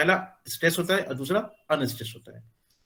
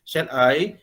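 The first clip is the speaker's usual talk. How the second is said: shall I with I lifted and rising; stress on I; 3 semitones lower, 3 dB louder than usual. Shown as a demonstration. Teacher repeats shall I, stressing I for the class. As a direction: push the I in shall I. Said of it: "यहाँ पे I stress हो सकता है".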